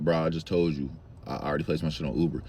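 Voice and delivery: low, flat, annoyed. Retold or imitated. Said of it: "I was like".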